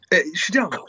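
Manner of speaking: usual speaking voice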